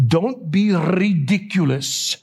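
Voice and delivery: slow Italian-immigrant dad impression; drawn-out Italian accent like a father. Brother teases his sibling with their dad's phrase, in an Italian accent. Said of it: "Italian accent".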